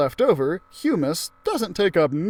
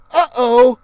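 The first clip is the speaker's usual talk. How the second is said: high, loud uh-oh; sudden worry at the buzzing; alarmed uh-oh; 9 semitones higher, 11 dB louder than usual. Acted. Narrator kid hearing the buzzing flies, loud alarmed uh-oh.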